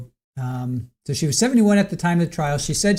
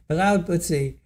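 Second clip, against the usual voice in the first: usual speaking voice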